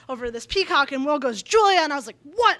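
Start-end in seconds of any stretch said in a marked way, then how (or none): 1.4-1.8 put-on child calling her name loud and high; an excited kid yelling across the stairs. 2.2-2.6 loud, high exasperated what; a fed-up camp counselor snapping back; frustrated snap. Retold or imitated. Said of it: "I was so frustrated".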